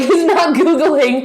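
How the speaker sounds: laughing through the words, slowed; can't hold back a laugh of disbelief. Real, not acted. Laughing disbelief at fiancé's trick.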